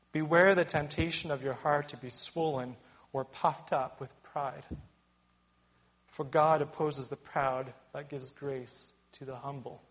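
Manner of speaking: ordinary talk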